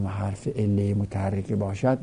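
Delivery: usual speaking voice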